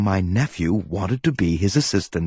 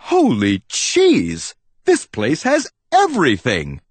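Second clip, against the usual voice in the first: amazed; 6 semitones higher, 3 dB louder than usual. Acted. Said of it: "I remarked".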